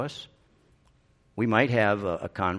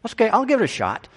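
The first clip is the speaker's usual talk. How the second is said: high, loud eager volunteering; 12 semitones higher, 6 dB louder, much faster than usual. Pretend. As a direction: jump at the chance, thrilled.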